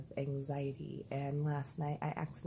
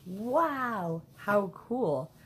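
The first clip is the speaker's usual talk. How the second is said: excited; 4 semitones higher, 5 dB louder, much slower than usual. Retold or imitated. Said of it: "I was like".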